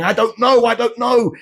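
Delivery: high-pitched, mildly screamed plea; begging scream. A narrator's reading. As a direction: plead in a high, strained mock scream. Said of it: "begging and screaming".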